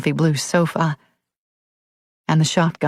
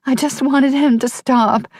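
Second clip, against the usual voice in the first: put-on whimpering girl; 8 semitones higher than usual. A narrator's reading. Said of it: "Herself whimpering".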